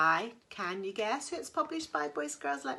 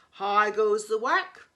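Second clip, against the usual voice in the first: loud bossy farmer call; lazy farmer bellowing from bed; 9 dB louder than usual. Acted. Farmer calling, repeated.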